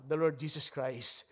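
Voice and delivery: ordinary delivery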